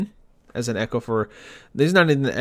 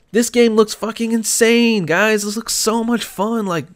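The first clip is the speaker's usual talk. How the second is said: heated excitement; 5 semitones higher than usual. Real, not acted. Podcaster ranting to listeners about Smash, excited and heated. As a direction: gush about the game like you can't contain it. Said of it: "I'm getting too heated here".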